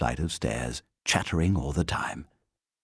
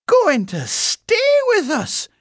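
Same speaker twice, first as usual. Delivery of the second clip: loud, slow, very high echo; aghast housekeeper; 7 dB louder than usual. A narrator's reading. Housekeeper hearing the bear will stay, aghast. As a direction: repeat it in drawn-out, high-pitched disbelief.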